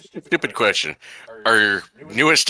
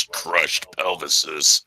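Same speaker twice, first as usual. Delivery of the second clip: low, very slow stern voice; playfully menacing barbarian cook; 5 semitones lower, much slower than usual. Pretend. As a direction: draw it out low, as a mock threat. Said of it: "looks at me with a stern look".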